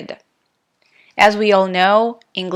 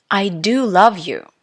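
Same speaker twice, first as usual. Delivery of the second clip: "do" stressed strongly, rising in pitch. Shown as a demonstration. Teacher giving an example of emphatic do, stress on "do". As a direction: lean hard on "do" for emphasis. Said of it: "how I pronounce these sentences".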